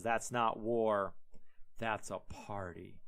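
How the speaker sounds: low, slow, dry deadpan; deadpan reveal. Retold or imitated. Moses to Joshua, dry low slow deadpan. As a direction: squint and drop it low and slow, like a punchline. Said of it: "He says, squints his eyes".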